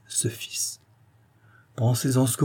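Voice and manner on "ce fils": hushed whisper of ce fils. A narrator's reading. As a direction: breathe the words ce fils, voiceless and soft. Narrator's hushed repeated word. (chuchoté, ce fils à voix basse)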